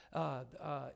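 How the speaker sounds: ordinary delivery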